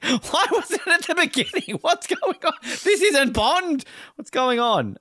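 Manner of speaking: very high, laughing disbelief; hammed-up shocked fan; mock outrage. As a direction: squeal it in comic bewilderment, stretching the words. Retells his shock, mock outrage.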